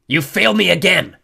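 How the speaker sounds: war god's thunderous rebuke; furious, booming accusation; angry bellow. A narrator's reading. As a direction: bellow it high and very loud. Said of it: "the war god bellowed".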